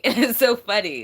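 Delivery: loud laugh carrying the words. Real, not acted. Reader delighted by the energy God sends, laughing. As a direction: let the laugh spill over the line.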